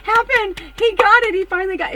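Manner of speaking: high, loud overjoyed cry; hammed-up ecstatic shriek. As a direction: tell all your friends, bursting. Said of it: "and be like".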